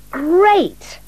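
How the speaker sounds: high, bright cry of delight; excited shout. A narrator's reading. Max sees the bright village and shouts with delight. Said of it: "Max shouted".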